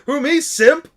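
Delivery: high, loud, mock-indignant squeak; mock-offended surprise. Real, not acted. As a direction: feign shock at being called a simp. Mock-denying being a simp.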